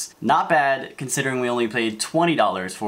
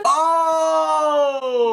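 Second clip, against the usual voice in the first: surprised gasp; 14 semitones higher, 4 dB louder than usual. Real, not acted. Rainbow rare card pulled.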